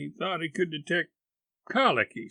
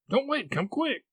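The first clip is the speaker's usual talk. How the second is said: very high, panicked urging; frantic boy's high voice; urgent plea; 12 semitones higher than usual. Acted. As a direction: hurry her along in alarm. Boy urging aunt to hurry.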